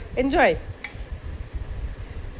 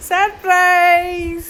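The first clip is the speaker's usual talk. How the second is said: drawn-out, louder cry of surprise; excited reveal; 4 semitones higher, 5 dB louder, much slower than usual. Real, not acted. Parent unveiling a gift to the child, long excited call.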